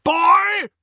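loud, high angry bellow; furious uncle bellowing. A narrator's reading. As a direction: roar the word up the stairs. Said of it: "his uncle bellowed".

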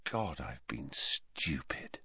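slow, low near-whisper; quiet realisation. A narrator's reading. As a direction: murmur it to yourself as it dawns. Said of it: "he said quietly".

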